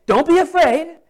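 a bright call from the angel; angel's greeting. Retold or imitated. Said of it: "they say".

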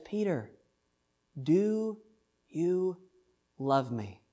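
do, you and love each set apart by pauses; slow, word by word. Retold or imitated. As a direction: ask it one word at a time. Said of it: "Jesus says".

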